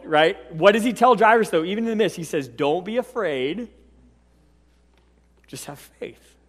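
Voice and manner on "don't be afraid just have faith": soft reassurance. Acted. Jesus comforts Jairus.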